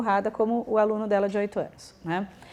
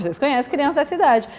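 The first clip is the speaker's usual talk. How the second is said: ordinary talk, nothing marked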